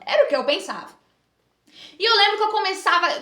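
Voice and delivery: ordinary delivery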